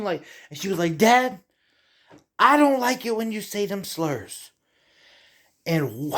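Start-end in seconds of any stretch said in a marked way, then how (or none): 1.0-4.5 a hint of a higher child voice; daughter's complaint. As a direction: lightly play his young daughter scolding him. Voicing the youngest daughter's complaint.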